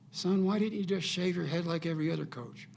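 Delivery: deadpan tease in the voice of the scout; serious old scout. Retold or imitated. An old baseball scout, deadpan, teases the coach at tryouts. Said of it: "he looks at me with a serious face".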